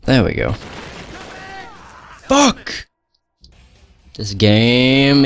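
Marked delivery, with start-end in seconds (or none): 2.3-2.9 sudden high curse; furious yelp. Real, not acted. Player dying again yelps in high fury. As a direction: spit out the swear way up high.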